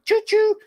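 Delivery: high train whistle; choo choo. Pretend. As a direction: toot it like a train. Celebrating, train noise.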